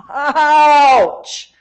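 high, drawn-out mock ouch; hammed-up cry of being stung; mock pained ouch. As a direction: wince theatrically at the verbal blow. Mock reaction to a stinging rebuke.

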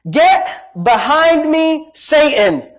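high, slow, forceful rebuke; stern rebuke. Acted. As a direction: rebuke him hard, drawing out the first words. Acts out Jesus rebuking Peter.

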